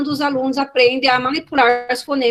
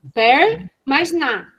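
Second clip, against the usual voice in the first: slowly drawn-out PER, pause, then NA; very slow teaching delivery; syllable demonstration; much slower than usual. Shown as a demonstration. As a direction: spell out the sum by syllables. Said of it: "manipulação silábica".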